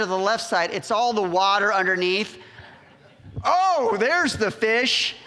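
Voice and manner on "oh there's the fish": high, loud burst on Oh, then slow; sudden thrill at the full nets; excited discovery. Retold or imitated. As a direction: gasp of delight as the catch appears. Retelling the sudden big catch.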